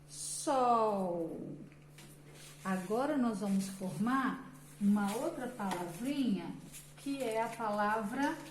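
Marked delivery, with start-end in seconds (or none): none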